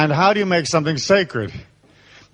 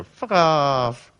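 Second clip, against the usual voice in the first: flat dismissive fuck off; a hint of defiance; firm brush-off. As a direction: shut them down calmly. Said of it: "you step back and you go".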